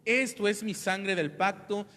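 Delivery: loud, measured Bible reading; solemn scripture reading. Acted. Pastor reads the Last Supper to the congregation, solemnly. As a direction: proclaim the passage to the church.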